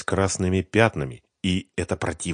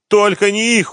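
high, loud, desperate plea; frantic pleading; begging; 9 dB louder than usual. A narrator's reading. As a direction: implore them in a high wail. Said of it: "умолял Семён".

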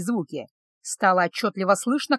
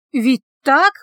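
higher, louder, rising question; alarmed self-doubt; 4 semitones higher, 7 dB louder than usual. A narrator's reading. Healer doubts the meat was elk, growing alarmed. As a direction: push the doubt towards alarm.

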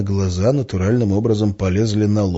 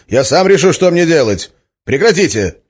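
loud, defiant, pitched-up shout; 8 semitones higher, 7 dB louder than usual. A narrator's reading. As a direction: shout to make them stop. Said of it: "закричал я". (громкий крик протеста)

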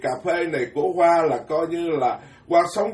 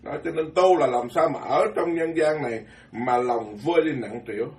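ordinary talk, nothing marked

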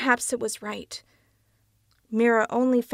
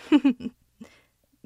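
gloating laugh; 6 semitones higher, 5 dB louder than usual. A narrator's reading. Franz gloats.

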